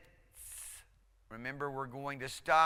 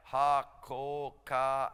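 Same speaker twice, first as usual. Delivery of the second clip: slow syllables; 5 dB louder, slower than usual. Shown as a demonstration. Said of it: "breaking it down into syllables".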